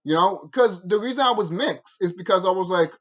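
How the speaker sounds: ordinary delivery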